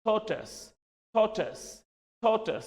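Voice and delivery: "tortoise" as TOR-tus, short weak ending; pronunciation drill. Shown as a demonstration. Teacher drills the word for students, TOR-tus. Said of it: "Be correct about the pronunciation".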